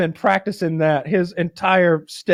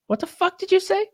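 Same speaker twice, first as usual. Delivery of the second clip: high, hushed menacing challenge; offended gangster; 4 dB quieter than usual. Retold or imitated. Gangster just insulted by his buddy, high soft challenge. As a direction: quietly challenge him, pitched right up. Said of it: "And he goes".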